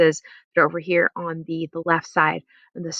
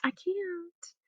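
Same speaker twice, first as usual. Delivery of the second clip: much softer, trailing regret; soft regretful no; 4 semitones higher, 11 dB quieter, much slower than usual. Shown as a demonstration. Teacher acts a soft regretful decline, quietly. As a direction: let it fade away apologetically.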